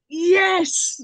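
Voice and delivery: bright, loud cheer of triumph; excited cheer. Retold or imitated. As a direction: yell yes like the whole room cheering. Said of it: "all of us were like".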